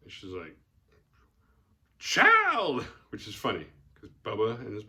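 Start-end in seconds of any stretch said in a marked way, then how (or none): 2.0-2.8 put-on high, loud drawn-out "Child!"; old woman's exclamation. Bubba's mom finds the girl at night, startled cry of "Child!".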